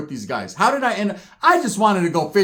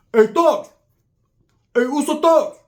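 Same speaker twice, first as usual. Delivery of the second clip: loud, raised door-side holler; calling out; 10 semitones higher, 4 dB louder than usual. Retold or imitated. Tongan calling for his relative, loud high holler. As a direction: shout up to someone inside the house.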